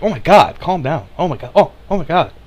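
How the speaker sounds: high, gasping, flustered imitation; mock appalled critic. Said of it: "they're like".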